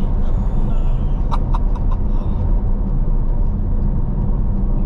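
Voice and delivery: high breathless burst of laughter; shocked laughter. Real, not acted. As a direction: laugh out the adrenaline. Laughing after the launch. (éclat de rire sous le choc)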